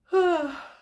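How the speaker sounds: high, loud sigh of release; big sigh. Acted. Showing the relief of crying it out, sighing. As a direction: let it all out in one sigh.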